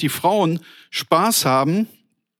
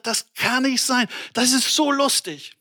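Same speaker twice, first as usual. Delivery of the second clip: higher and higher, reliving hilarity; retold amused disbelief; 6 semitones higher than usual. Preacher recalls falling off his chair laughing, retold amused disbelief. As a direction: exclaim it as if it's too funny to bear.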